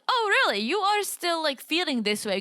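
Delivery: high-pitched mock disbelief; incredulous reaction. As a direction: question it in high, acted surprise. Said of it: "I'm kind of like".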